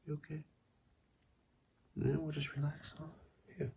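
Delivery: soft, hushed check-in; hushed concern. Real, not acted. Owner softly asking her hiccuping cat if he's okay. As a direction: ask gently under your breath.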